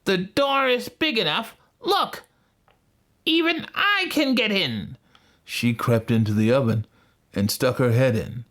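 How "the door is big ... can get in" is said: very high witch voice; impatient witch. A narrator's reading. Witch showing Gretel the oven, high impatient voice. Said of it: "said the witch".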